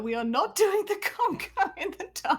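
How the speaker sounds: laughing through a firm no; laughing refusal. Real, not acted. Player laughing off the conga idea, giggling no. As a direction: refuse while cracking up.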